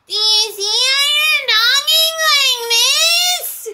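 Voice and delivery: very high, loud, drawn-out wail; screechy mocking mimicry, stretched out slowly; mocking imitation. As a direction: parody her obnoxious loud voice. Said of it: "You see how I'm talking like this?".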